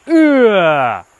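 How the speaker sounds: loud frustrated grunt; mock angry ugh, high and loud. Shown as a demonstration. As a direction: growl it out like a fist slam. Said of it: "Being mad is me taking my fist and".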